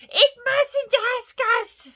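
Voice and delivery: high, loud, slow shriek; screeching creature voice; Gollum shriek. A narrator's reading. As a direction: shriek in outraged anguish. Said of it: "shrieked Gollum".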